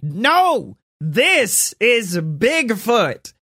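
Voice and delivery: high, slow ranting cry; shouting buster. Acted. Cryptid buster crying out at Bigfoot, high shrill rant. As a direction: rant like a crank. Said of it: "you just hear, like".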